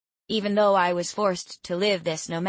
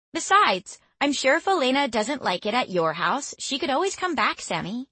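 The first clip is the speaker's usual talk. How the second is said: lightly voiced young woman; 7 semitones higher than usual. Becky's teasing dialogue, raised voice.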